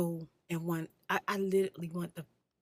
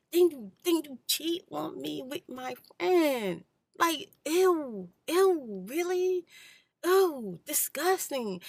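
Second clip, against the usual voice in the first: much higher and louder, slow, recoiling; disgusted; 9 semitones higher, 8 dB louder, slower than usual. Real, not acted. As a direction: shriek out the disgust like you smelled something foul. Recoiling at cheating with friend.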